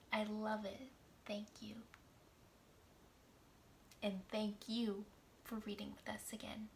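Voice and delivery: usual speaking voice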